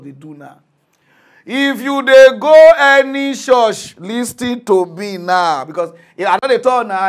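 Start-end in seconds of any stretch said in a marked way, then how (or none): 1.5-7.1 higher, louder and slow, demanding attention; urgent shout. Real, not acted. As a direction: hammer the warning home. Urgent warning to churchgoers.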